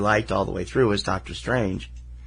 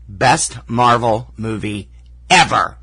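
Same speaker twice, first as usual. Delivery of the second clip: Best Marvel movie ever, slow and loud with ever punched; emphatic; 5 dB louder, slower than usual. Real, not acted. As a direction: punch each word, big finish on ever. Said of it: "I can give it a review".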